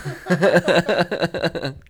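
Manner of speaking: loud, high rapid cackle; laugh. Real, not acted. Reader cracking up at the mistake, loud high laughter. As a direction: burst out laughing loudly.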